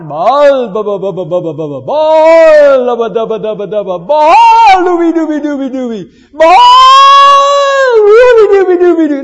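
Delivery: gritos agudos y rítmicos de profetas frenéticos; burla de oración pagana. Acted. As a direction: canturrea burlón como profeta de Baal, agudo y muy fuerte. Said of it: "Y comenzaron a orar". (imitando a gritos la oración a Baal)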